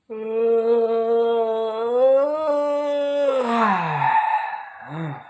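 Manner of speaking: long drawn-out yawn, sighing out. Acted. Winding down, getting tired.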